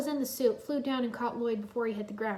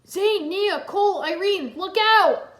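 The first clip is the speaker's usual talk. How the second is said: high, loud shout; warning call; 9 semitones higher, 11 dB louder, slower than usual. A narrator's reading. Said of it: "Lloyd called".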